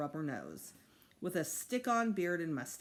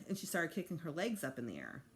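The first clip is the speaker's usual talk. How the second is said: ordinary delivery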